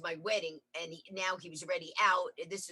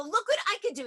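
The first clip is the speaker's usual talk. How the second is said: excited boast; 13 semitones higher, 5 dB louder, faster than usual. Retold or imitated. Showing husband the adventure.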